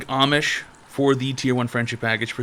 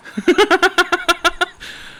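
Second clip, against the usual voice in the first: high amused burst of laughter. Real, not acted. Laughing at own sarcasm.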